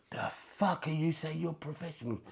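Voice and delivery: high mock yell of a furious sergeant major; a hint of a shouting drill sergeant; mock coach yelling. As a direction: tear into the late players. Coach yelling at drunk players.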